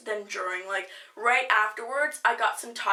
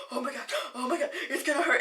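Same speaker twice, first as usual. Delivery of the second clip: nervous laughing dread; 2 semitones higher, much faster than usual. Retold or imitated. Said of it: "you're like".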